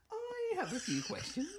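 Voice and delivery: high, slow put-on character voice; quoted TV character. Retelling a show quote to mates, high slow mock voice. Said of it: "And it was just".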